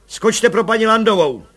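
loud, high barked command; order. A narrator's reading. Commissioner sending for Mrs Landová, loud barked order. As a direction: snap out the order to the men.

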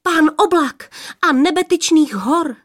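high, excited outburst; girl's overawed proclamation; blurting breathlessly. A narrator's reading. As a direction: blurt it out, voice catching. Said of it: "třetí vyhrkla, až se jí zajík hlas".

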